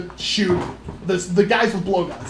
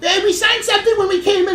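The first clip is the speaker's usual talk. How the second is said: mock mom; 10 semitones higher, faster than usual. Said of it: "she goes".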